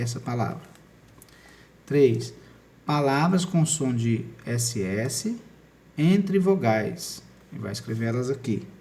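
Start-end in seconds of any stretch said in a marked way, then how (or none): 1.9-7.2 slow emphasis. Shown as a demonstration. Teacher stresses spelling rule.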